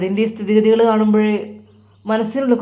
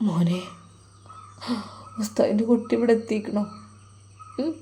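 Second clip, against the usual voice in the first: weak whisper; 7 dB quieter than usual. Acted. Sick teacher speaks weakly.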